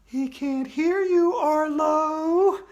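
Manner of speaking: put-on high, slow ghostly girl; eerie ghost girl teasing. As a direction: taunt him like a spooky ghost. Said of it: "said the ghost of Andrea".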